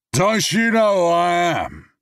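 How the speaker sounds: high, loud, slow slurred boast; drunken bravado. Acted. Drunk man to James, loud slurred boast. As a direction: ham up the drunk self-importance.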